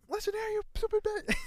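high, loud put-on telling-off; hammed-up high-pitched scolding; mock confrontation. Mock confronting the company, joking.

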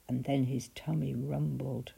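ordinary delivery

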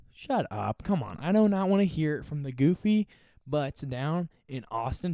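ordinary talk, nothing marked

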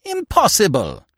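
high, startled outburst; blurted disbelief. A narrator's reading. Aunt reacts to the removed bullets, blurting in shock. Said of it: "she blurted out".

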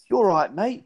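exaggerated fake British accent, rising at the end; bad British accent. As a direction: imitate a botched British greeting, high and lilting. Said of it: "bad British accent say".

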